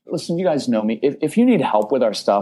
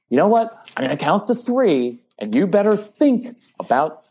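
raised, pushy parent threat counting to three; mock threat; 7 semitones higher than usual. Parent threatening a child, in a mock high-pitched warning. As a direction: play the freaked-out parent, pitch jumping up. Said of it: "instead of doing the threatening".

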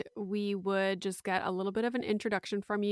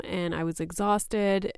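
usual speaking voice

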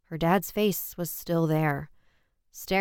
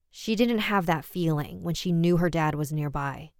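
ordinary delivery, nothing marked

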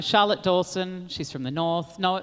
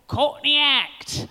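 put-on Australian accent; 8 semitones higher, 3 dB louder, much slower than usual. [name] saying her name, slowly and higher, in an Australian accent. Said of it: "[name] would always say my name with an Australian accent".

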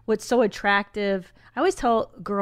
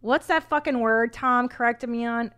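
ordinary talk

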